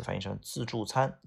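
usual speaking voice

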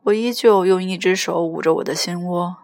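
ordinary delivery, nothing marked

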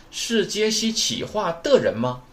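slow, high-pitched question with a rising end; deliberate teacher's translation, lifted at 吗; slow word-by-word. Shown as a demonstration. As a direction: spell the translation out piece by piece.

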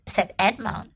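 plain reading voice

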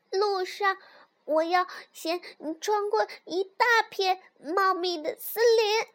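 high, bold cub voice; little fox. A narrator's reading. Little fox tells mother the travel plan, high fox voice. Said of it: "小狐狸回答说".

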